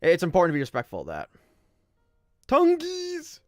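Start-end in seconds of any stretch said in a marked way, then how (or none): 2.5-3.4 high-pitched squealed greeting; playful call-out. Real, not acted. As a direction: sing out the name, way up high. Calls out to chat.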